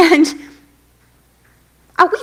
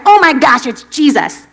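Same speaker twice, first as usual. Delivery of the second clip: light up as you spot him; excited Peter; 3 semitones higher, 6 dB louder than usual. Retold or imitated. Peter spotting Jesus on the shore, excited. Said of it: "Or maybe he was like".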